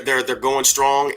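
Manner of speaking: ordinary talk, nothing marked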